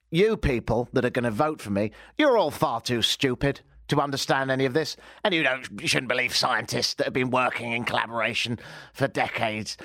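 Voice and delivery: lightly put-on posh English accent as Ben Carson; a hint of a plummy English politician begging for votes; light mock English accent; ridiculously English. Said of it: "I went ridiculously English".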